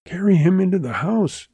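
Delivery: high, urgent plea from a woman; imploring. A narrator's reading. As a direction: beg them, pitched well up. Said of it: "she implored".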